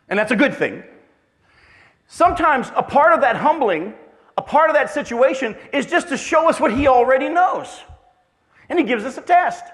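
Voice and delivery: loud, high pulpit preaching; preaching at full force. Acted. Pastor preaching to his church, loud and emphatic. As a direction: drive the point home to the congregation.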